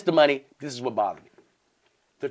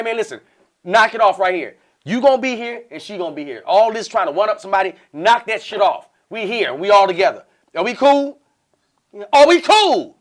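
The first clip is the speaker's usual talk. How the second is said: loud, raised, insistent scolding; putting foot down; 5 semitones higher, 7 dB louder than usual. Retold or imitated. Man ordering both women to get along, firm and loud. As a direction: lay down the law, building to a demand. Retold laying down the law to women.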